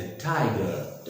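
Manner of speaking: slow टाइगर with a puffed t and silent r; long, falling 'thai-guh'; correct TIGER. Shown as a demonstration. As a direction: draw out टाइगर, aspirating the t. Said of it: "इस प्रकार से बोलेंगे".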